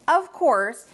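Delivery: ordinary delivery, nothing marked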